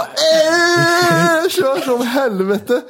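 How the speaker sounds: high, slow, mock cheering; hammed-up gull voice. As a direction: squawk it like a randy gull, stretched out. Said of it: "han bara". (härmad mås, hög och utdragen)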